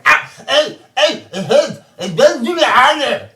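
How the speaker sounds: high, loud, laughing groans; pained groaning. Acted. Game master voicing the hurt monster groaning after biting its tongue. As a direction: moan it out high and loud while half laughing. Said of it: "And you, you".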